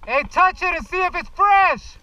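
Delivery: slow, loud call across the field; shouting. Real, not acted. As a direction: yell it out slowly. Calling to ref.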